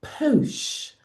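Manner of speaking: high, airy posh drawl; mock posh accent. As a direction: say it with an exaggerated posh vowel. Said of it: "You don't need to be".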